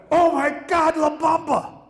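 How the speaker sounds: high, loud squealing fan shout; imitating a drunk fan shrieking, high and loud; drunk girl shout.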